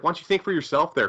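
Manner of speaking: usual speaking voice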